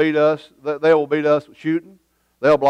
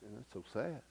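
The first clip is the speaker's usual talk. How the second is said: quiet sad thought; 4 semitones lower, 24 dB quieter than usual. Retold or imitated. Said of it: "you thought".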